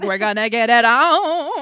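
loud, high hammed-up glee; mock eager. Mock lovers announcing their intent, loud and gleeful. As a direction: ham it up with cheeky glee. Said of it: "And they're like".

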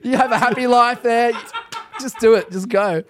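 loud, high, amused salesman-like persuasion; retold coaxing negotiation. Minister negotiating a demon out, retold coaxing. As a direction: coax it along, building to an urgent push. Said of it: "I'm negotiating with it".

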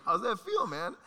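high teasing jab; high taunt. Retold or imitated. Taunting a friend after the hat flick, high. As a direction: needle him up high, gloating. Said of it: "I'm like".

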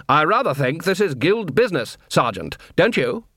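high, swooping superior voice; officious, condescending question; haughty rebuke. Acted. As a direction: put the sergeant in his place. Head clown haughtily dismisses Colon.

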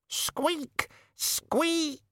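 very high, thin squeaks, the last drawn out; piercingly high rat squeaking; insistent rat squeak. A narrator's reading. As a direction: interrupt with shrieky rodent squeals. Rat interrupts the raven.

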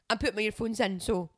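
blunt loud quote. Retold or imitated. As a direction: spit it out bluntly. Imitating partner again.

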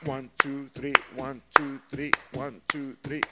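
loud, rhythmic "one, two, three" count; punched, evenly repeated "one, two, three" in time; syncopated counting. Shown as a demonstration. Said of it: "That's the basic syncopation".

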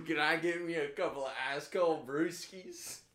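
hammed-up Southern bar order; put-on country twang; exaggerated Southern accent. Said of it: "accentuating his southern accent".

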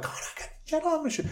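high, soft, quick panicky squeal; mock freak-out. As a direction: imitate her freaking out, high and rushed. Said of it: "freaking out".

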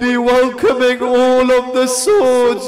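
very loud, high, slow wailing oratory; preacher lamenting at full force; impassioned lament sermon. Real, not acted. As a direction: stretch each phrase into a grieving cry.

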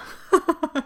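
short laugh. Real, not acted. Laughing about painful infusion.

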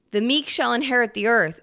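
ordinary talk

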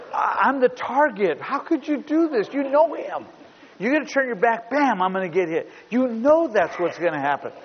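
mock-indignant boy, rising high; upset teen son. Father retelling son's outburst, whiny high protest. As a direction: whine it like an offended kid.

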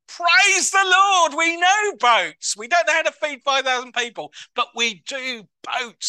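high, loud, slow cocky sailors; excited boasting. Retold or imitated. Disciples boasting to each other about boats, loud and cocky. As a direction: crow it like proud fishermen.

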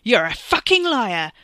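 loud, high furious yell; enraged shout; angry yell. A narrator's reading. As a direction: yell the accusation at the liar. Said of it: "Wes yelled".

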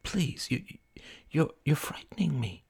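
soft, near-whispered, halting plea; hushed fearful voice; frightened plea. Acted. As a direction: beg gently, stumbling on the words. Frightened friend pleads.

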